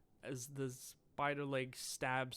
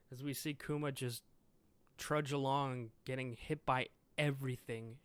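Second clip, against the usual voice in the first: ordinary delivery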